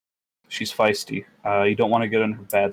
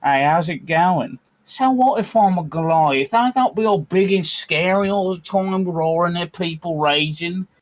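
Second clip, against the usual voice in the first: Wolfgang's gentle voice; 7 semitones higher, 3 dB louder than usual. Acted. Host voicing Wolfgang, a gentle giant raised by humans. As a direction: play a big friendly giant who won't roar. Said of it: "He talks like this".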